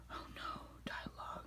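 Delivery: gasp, then whisper the name in mock dread; a hushed, hammed-up gasp of dread; mock fearful gasp. Telling viewers about the villain, mock-scared whisper.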